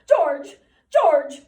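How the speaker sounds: loud, high desperate calls; crying out. A narrator's reading. Lenny calls for George in despair, loud desperate cry. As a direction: call out for help in despair. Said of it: "and he cried".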